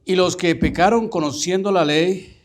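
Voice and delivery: versículo proclamado fuerte y más agudo; relectura enfática. Shown as a demonstration. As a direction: recalca cada parte con voz fuerte. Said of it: "Y te lo voy a decir así". (lectura enfática en voz alta)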